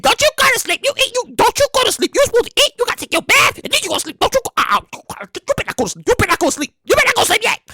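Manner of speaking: high mock-stern mom; scolding parent. Parent scolding a sleepy kid, high mock-stern.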